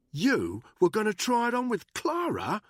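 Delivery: high, loud incredulous question; rising stunned anger; outraged disbelief. A narrator's reading. Danny learning Buckingham courted Clara, loud disbelief.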